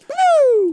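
very loud, high-pitched "woo"; party whoop. Pretend. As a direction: let out a piercing strip-club cheer. Cheering the Pink Pony strip club.

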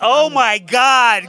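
loud, high, slow exclamation; stunned, belted reaction; amazed recognition. Real, not acted. Reacts to picture of his look-alike.

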